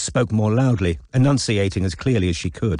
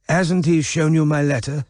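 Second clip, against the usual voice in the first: put-on frail old man; 6 semitones higher than usual.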